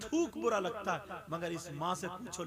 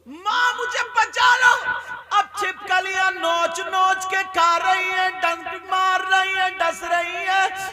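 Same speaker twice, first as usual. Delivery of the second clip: high, loud, slow chanted preaching; impassioned sermon chant; preaching at full force; 12 semitones higher, 19 dB louder, slower than usual. Acted. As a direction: wail it out like a fiery preacher over the crowd.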